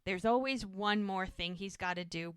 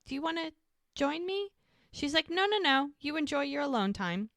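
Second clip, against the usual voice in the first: lightly voiced exchange, pitched much higher; high retold voices; 8 semitones higher than usual. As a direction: play both sides up high.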